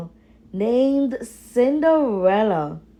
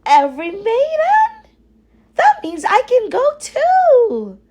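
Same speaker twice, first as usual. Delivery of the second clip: much higher, louder and quick with delight; excited Cinderella; 5 dB louder, faster than usual. Acted. Cinderella learns she may go to the ball, much higher and excited. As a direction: gasp it out, thrilled to be invited.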